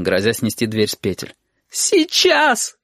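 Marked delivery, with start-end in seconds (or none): 1.7-2.8 высокий крик сквозь слёзы; крикни в отчаянии. Acted. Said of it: "крикнула Сашка". (Сашка кричит родителям)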